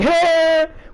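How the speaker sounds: high, loud scornful laugh burst; loud mocking laugh. Real, not acted. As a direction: cackle at the absurdity. Laugh after sarcasm.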